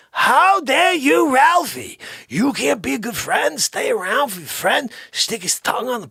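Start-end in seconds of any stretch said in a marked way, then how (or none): 0.1-4.3 indignant scolding. Acted. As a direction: drag out the scolding in mock fury.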